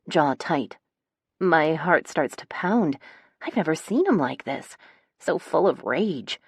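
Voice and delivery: ordinary delivery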